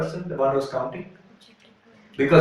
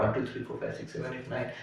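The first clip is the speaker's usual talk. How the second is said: hushed counting; 3 semitones lower, 16 dB quieter, faster than usual. Acted. Counting the friends again, dismayed.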